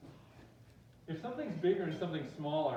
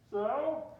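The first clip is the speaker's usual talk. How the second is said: high, loud mock challenge; defiant back talk; 9 semitones higher, 8 dB louder than usual. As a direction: throw it back at the cop, hammed-up. Student defies the cop.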